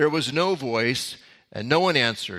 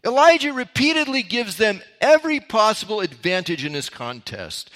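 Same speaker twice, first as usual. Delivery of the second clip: ordinary talk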